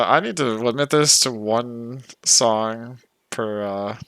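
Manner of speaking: usual speaking voice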